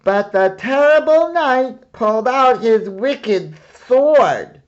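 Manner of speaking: loud, high storyteller boom. Acted. Boy reads aloud to the hidden dragon, booming. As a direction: read it big to lure the dragon. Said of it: "read aloud in a booming voice".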